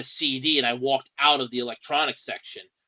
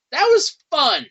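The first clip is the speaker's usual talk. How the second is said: loud, high, sarcastic punch; 12 semitones higher, 8 dB louder, slower than usual. Real, not acted. Venting about a customer's complaint, loud sarcasm. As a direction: sound off with mock enthusiasm.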